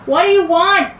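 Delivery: loud, high, hostile demand through the door; angry shout. Acted. Homeowner yelling at intruders outside her door, angry shout. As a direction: yell it at the strangers at her door.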